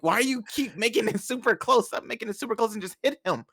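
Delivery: high-pitched retold exasperation; freaking-out mom. Mom watching son's close match, freaking out. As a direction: imitate a mother urging frantically. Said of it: "'cause she was freaking out".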